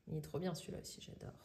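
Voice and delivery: whisper. Real, not acted. Hushed aside about a favourite tale.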